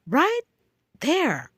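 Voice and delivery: RIGHT pitched high, then "there" dropped; slow emphatic right there. Real, not acted. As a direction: split it into two heavy beats. Stressing kids are right there.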